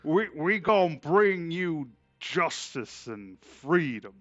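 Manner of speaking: loud, slow, hammed-up conqueror speech; grandstanding like a proud patriot; mock patriotic American.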